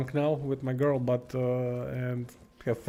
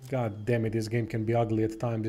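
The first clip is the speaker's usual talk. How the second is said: ordinary delivery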